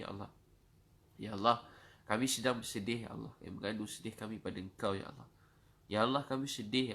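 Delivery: insistent plea with loud surges on each appeal; forceful, repeated supplication; urgent prayer. Real, not acted. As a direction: cry out for help, then drop on "ya Allah". Repeated cries to God for help.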